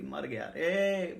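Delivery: drawn-out slow lament; mild mock wail. Potter's wife lamenting her dead son, slow wail. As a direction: stretch the grief out slowly.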